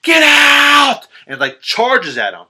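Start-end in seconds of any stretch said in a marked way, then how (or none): 0.0-1.1 full-force high shout; screamed warning. Retold or imitated. Logan suddenly screams at Chris, high frantic shout. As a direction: scream it at the top of your voice. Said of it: "all of a sudden he just screams".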